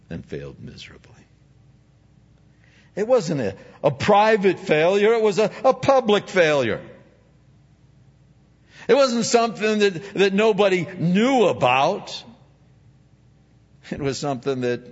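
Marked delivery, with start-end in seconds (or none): 0.1-1.1 quick hushed whisper. Acted. Peter's failure, hushed. 3.0-12.2 louder and higher emphatic sermon; preaching at full force. Acted.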